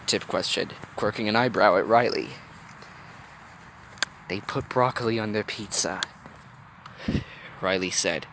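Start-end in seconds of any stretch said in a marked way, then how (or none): 4.3-6.0 hushed, revealing a dark secret; soft mock-ominous revelation; low, conspiratorial. A narrator's reading. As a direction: confide it softly and gravely. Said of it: "keeping her voice low as if she was revealing the darkest of truths".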